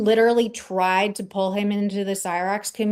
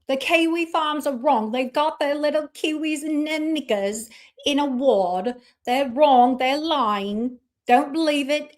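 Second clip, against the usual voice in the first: higher-pitched put-on English accent; mock English accent; 4 semitones higher than usual. Streamer mimicking a critic denouncing the farms, mock English accent. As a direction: imitate an accuser in an exaggerated English accent. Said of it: "with an English accent".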